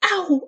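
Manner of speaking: high-pitched yelp of "ow"; pained cry. A narrator's reading. Boy whose ears are pinched, pained cry. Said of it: "Eric cried".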